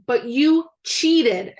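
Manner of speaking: slow, loud accusing shout; drawn-out shout. A narrator's reading. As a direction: drag out the accusation. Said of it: "shouted Tom".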